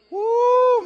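loud, high "woo" of awe; impressed, half-alarmed whoop. Real, not acted. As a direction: let out a long high-pitched whoop. Mock awe at a fearsome fighter.

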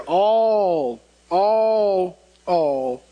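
"all" drawn out each time, pause between; repeated emphatic all. Real, not acted. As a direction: hammer "all" in long held beats, dropping at the end. Preacher hammering one scripture word.

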